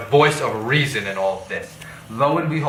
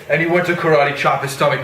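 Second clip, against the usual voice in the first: usual speaking voice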